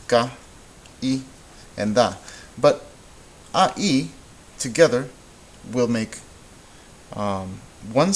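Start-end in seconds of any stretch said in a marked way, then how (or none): 0.1-2.1 "Ca, i, and da" in three separate beats; syllable by syllable. Shown as a demonstration. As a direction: break caída into ca, i, da with pauses between. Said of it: "You should hear three syllables".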